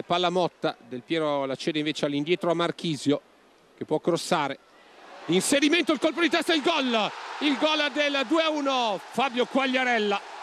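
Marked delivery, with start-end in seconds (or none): 5.3-10.4 higher, rising build to the goal; excited goal call. Real, not acted. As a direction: shout the goal as it happens. Live goal call, Juve second.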